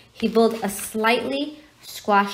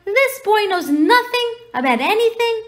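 high, loud outraged giant; roaring disbelief, voice pushed right up; angry growl; 12 semitones higher, 6 dB louder than usual. A narrator's reading. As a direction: build to an indignant shout. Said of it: "he growled at Dursleys".

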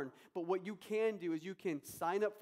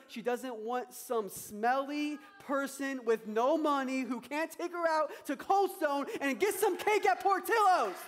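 building louder and higher to the punchline; preaching build, high and loud; rising preaching peak; 7 semitones higher, 7 dB louder than usual. Real, not acted. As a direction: drive the joke home loud and high.

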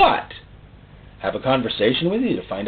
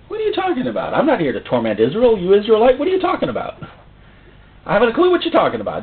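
incredulous Jesus; 7 semitones higher, faster than usual. Retold or imitated.

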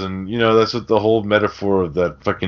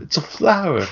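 mock riddle answer; 9 semitones higher than usual. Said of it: "the answer is, like, stupid".

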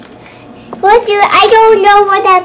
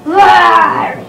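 lion roar. Pretend. Child imitating lion.

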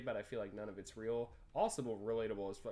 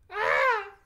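startled yelp; 18 dB louder than usual. Real, not acted. Game character fails a jump.

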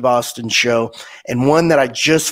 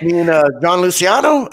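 laughing; 8 semitones higher, faster than usual. Real, not acted. Joking reply through laughter.

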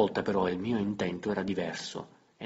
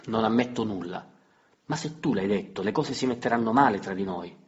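raised, louder voice in a sharp exchange; tense quarrel; 3 semitones higher, 7 dB louder than usual. Acted. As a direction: snap back in the argument. Botta e risposta del litigio. (battibecco teso, voce alzata)

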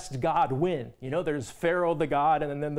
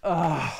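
hammed-up weary sigh; acted groaning sigh; exasperated sigh; 3 dB louder than usual. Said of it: "God gives an exasperated sigh".

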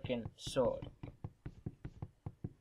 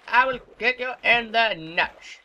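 zombie threat; 8 semitones higher, 7 dB louder, slower than usual. Acted. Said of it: "Said if you do it".

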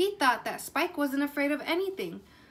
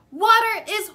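much higher and louder, fear bursting out; scared blurt; 7 semitones higher, 12 dB louder than usual. Acted. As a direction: blurt it out, unable to hold it in. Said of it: "he blurted".

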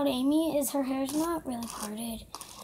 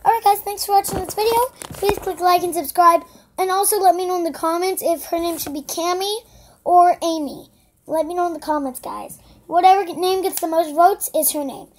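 loud, bright vlog sign-off; excited outro; 4 semitones higher, 12 dB louder than usual. Real, not acted. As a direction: shout the goodbye to viewers.

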